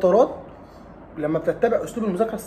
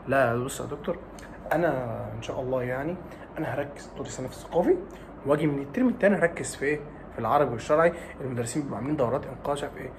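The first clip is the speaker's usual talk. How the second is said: a quieter, casual student excuse; softer student voice; 5 semitones lower, 7 dB quieter than usual. Retold or imitated. Student brushing off the doctor's advice, softly. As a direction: shrug it off like a cocky pupil.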